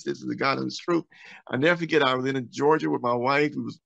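ordinary talk

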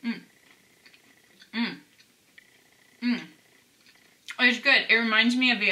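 chewing while humming; talking between bites; mouth full. Real, not acted. Seen 1: Bites cookie at -2, chews with closed mouth through the mms.

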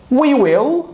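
"We will" as two words, rising on we; full form we will. Shown as a demonstration. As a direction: drill the long form. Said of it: "Bunun okunuşu".

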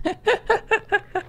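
short amused laugh. Real, not acted. Laughs, thinks he got someone.

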